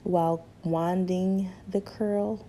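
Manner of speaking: ordinary delivery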